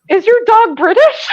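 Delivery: blurting it out through a laugh; half-shouted, laughing disbelief. Real, not acted.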